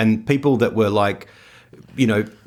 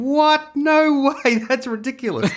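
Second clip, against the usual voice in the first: much higher, hammed-up outrage, ending in a laugh; mock disbelief. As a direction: play it as an over-the-top shocked protest. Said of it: "and you're going".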